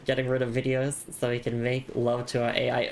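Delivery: usual speaking voice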